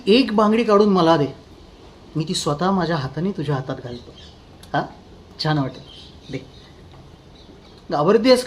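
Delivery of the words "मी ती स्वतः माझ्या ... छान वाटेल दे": quiet menacing. Acted. Soft menacing offer to wife.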